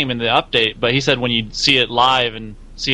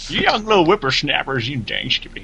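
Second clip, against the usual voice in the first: lightly voiced grumpy old-timer; crotchety old man. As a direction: scold like a cranky grandpa. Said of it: "that's not really a southern accent".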